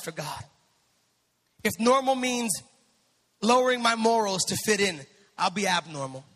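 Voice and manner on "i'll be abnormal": normal talking voice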